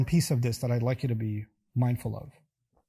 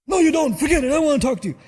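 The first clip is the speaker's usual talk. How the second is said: furious parent; 11 semitones higher, 6 dB louder, faster than usual. Retold or imitated. Parent shouts, refusing to listen.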